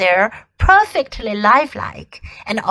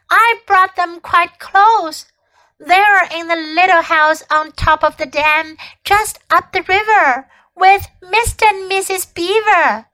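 put-on pleading boy; 6 semitones higher than usual. A narrator's reading. Edmund pleading with witch.

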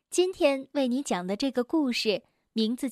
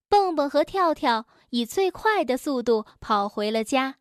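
usual speaking voice

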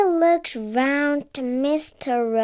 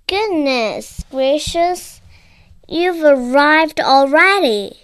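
flustered, louder cry of dismay; horrified; 8 dB louder than usual. Acted. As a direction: gasp it out as if the house is on fire. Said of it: "in horror".

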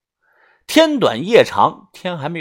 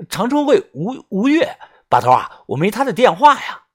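high-pitched, stumbling protest; flustered Yunfeng; 7 semitones higher than usual. Acted. Yunfeng telling boss he lacks the number, flustered. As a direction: plead your excuse in a raised pitch.

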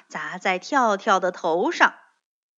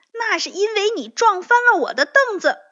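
high, fast, loud outburst; angry yell; 11 semitones higher, 7 dB louder, faster than usual. A narrator's reading. Bengbeng blames Tiaotiao for the stool, yelling fast. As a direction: yell it back fast and pitched up. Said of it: "蹦蹦吼道".

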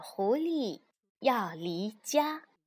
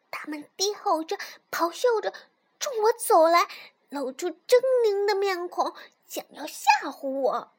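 high, dramatic cub voice; little fox; 7 semitones higher, 5 dB louder than usual. A narrator's reading. Little fox describes tigers to mother, high dramatic voice.

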